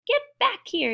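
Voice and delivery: high, urgent recall; yelling. A narrator's reading. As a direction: call them back, pitched very high. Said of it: "yelled Pip".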